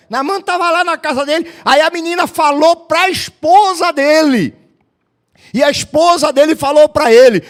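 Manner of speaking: loud, high storytelling sermon voice; preaching at full force. Acted. Preacher telling Naaman's story loudly to the church, at full force. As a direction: pastor pounding out the story to the congregation.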